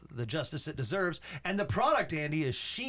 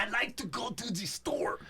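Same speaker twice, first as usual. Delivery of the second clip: a mock Russian accent, slightly higher; a hammed-up Russian tourist; 4 semitones higher than usual. Cohost demos a put-on Russian accent. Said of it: "I could do Russian".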